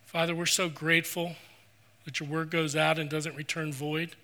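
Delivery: soft, reverent prayer voice; closing prayer. Acted. Pastor leading the closing prayer, soft and reverent. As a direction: pray quietly and humbly for the congregation.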